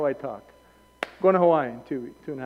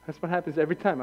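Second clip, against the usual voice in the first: ordinary delivery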